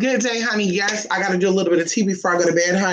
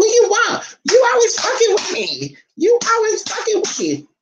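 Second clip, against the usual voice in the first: much higher put-on voice for the dog, griping; voicing the dog. Owner voicing her dog complaining back at her, high put-on voice.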